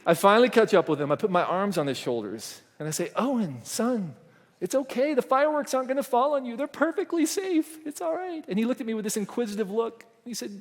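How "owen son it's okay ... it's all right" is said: soothing dad. Retold or imitated. Dad soothing scared son.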